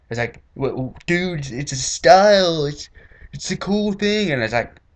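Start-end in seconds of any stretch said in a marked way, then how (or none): 0.5-4.3 slow, high, dopey dude voice; hammed-up slacker drawl; mock dumb teen. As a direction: play the clueless kid defending his style. Imitating the teens' reply.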